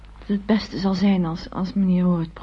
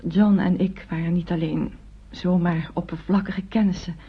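ordinary talk, nothing marked

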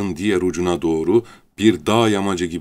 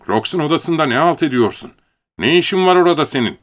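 high, sharp, louder scold; displeased scolding; 9 semitones higher, 4 dB louder than usual. Acted. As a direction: tell him off for being there. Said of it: "hoşnutsuz bir tavırla".

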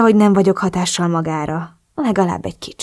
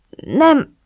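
stammering; 6 semitones higher than usual. A narrator's reading.

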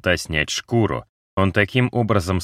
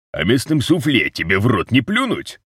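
creaky voice; 6 semitones higher, 3 dB louder than usual. A narrator's reading. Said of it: "проскрипел Рузе".

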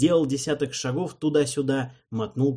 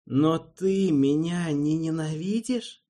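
uneasy disbelief; 6 semitones higher, slower than usual. A narrator's reading. Alvin unsure question.

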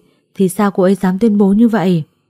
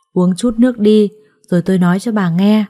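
plain reading voice

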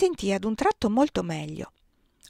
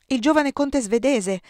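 higher, louder insinuating remark; 6 semitones higher, 5 dB louder than usual. A narrator's reading. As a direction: say it with pointed insinuation. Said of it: "disse la La Flotte insinuante". (tono insinuante, più acuto)

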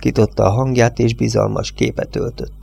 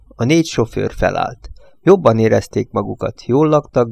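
plain reading voice

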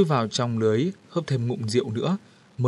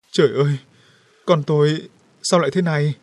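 acted wail, raised and louder; wailing mother; 4 semitones higher, 5 dB louder than usual. A narrator's reading. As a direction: lament over a son in a raised voice. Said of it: "bà Nghiêm gào khóc". (gào khóc)

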